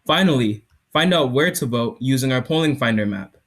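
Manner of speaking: loud, projected product demo; startup pitch. Acted. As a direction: sell it to the judges.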